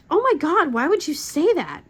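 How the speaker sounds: high, loud mock teen outrage; appalled teenager. Mother retelling her teenager's reaction to dad, appalled. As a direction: imitate a grossed-out teen protesting.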